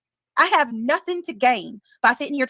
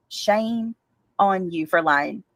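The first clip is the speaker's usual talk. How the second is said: slower, lower scolding; 5 semitones lower, much slower than usual. Real, not acted. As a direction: Shame dropped low and drawn out, on rising. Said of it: "scolding, lower and slower".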